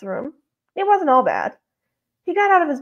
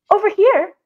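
higher-pitched woman calling over; 8 semitones higher, 3 dB louder than usual. A narrator's reading. Woman calls the man from a few steps over, calling out. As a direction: call out high from a few steps away. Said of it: "a woman called".

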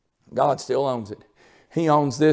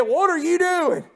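retold holler; 11 semitones higher than usual. Landlady scolding the man who kicked her trailer, mock holler. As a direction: imitate a lady hollering at a man. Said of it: "She hollered at him".

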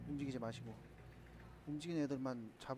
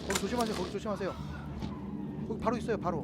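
raised, higher urgent callout; urgent warning shout; 6 semitones higher, 7 dB louder than usual. Real, not acted. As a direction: shout the warning fast to a teammate.

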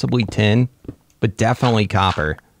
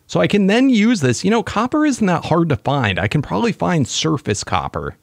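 usual speaking voice

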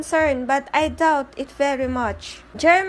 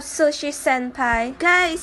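ordinary delivery

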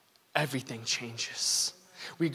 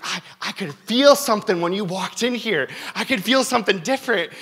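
higher and louder, marvelling; awed cashier; 7 semitones higher, 8 dB louder than usual. Retold or imitated. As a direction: voice the clerk's amazement. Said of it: "And he's like".

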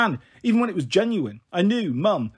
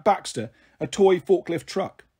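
usual speaking voice

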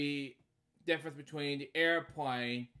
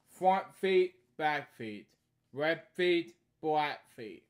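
lively sing-song read-aloud; storybook reading; 2 semitones higher, 5 dB louder, slower than usual. Acted. Reading a bedtime story aloud, lively. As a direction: read each line brightly to a child.

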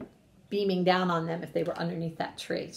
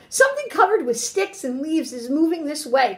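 higher-pitched squirrel warning; raised, urgent announcement; alarmed cry; 7 semitones higher, 5 dB louder than usual. A narrator's reading. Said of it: "cried Squirrel".

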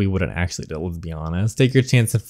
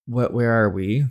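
usual speaking voice

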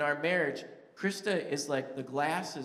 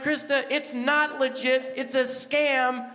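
loud, high, flat refusal; loud insistence; 9 semitones higher, 7 dB louder than usual. Retold or imitated. Husband shouting back at his wife that the prize is fake. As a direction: shout her down, it's a scam. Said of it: "And I'm like".

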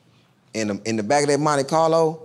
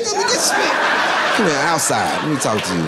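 panicked pull-aside; 3 semitones higher than usual. Retold or imitated.

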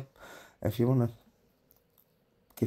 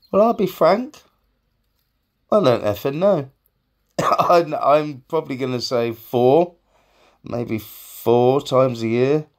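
much louder and higher, laughing; loud outburst; 6 semitones higher, 8 dB louder than usual. Real, not acted. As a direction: blurt it out loudly. Loud excited confession.

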